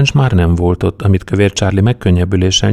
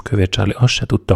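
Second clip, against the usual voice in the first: usual speaking voice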